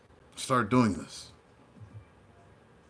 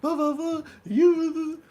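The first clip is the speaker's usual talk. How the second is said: much higher shrill mock rant; mad troll; 4 dB louder, much slower than usual. Imitating the angry trolls she blocks, high mock rant. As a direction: squeal like an enraged troll. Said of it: "They get so mad".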